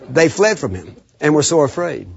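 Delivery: normal talking voice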